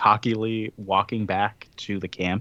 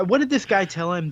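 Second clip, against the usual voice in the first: usual speaking voice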